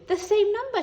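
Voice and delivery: high, loud excited shout. A narrator's reading. Little spider shouting to the classroom from the ceiling, high and loud. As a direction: burst out with it. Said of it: "shouted Spinderella".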